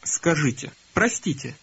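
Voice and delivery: normal talking voice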